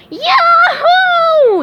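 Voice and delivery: very high, loud whoop; joyful yell. A narrator's reading. SpongeBob whoops at winning hall monitor, huge high yell. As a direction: burst out in triumph. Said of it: "yelled SpongeBob".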